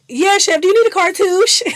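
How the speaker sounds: loud, high, quick call. Retold or imitated. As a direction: shout over the arguing to the chef. Acts Sydney cutting into shouting match.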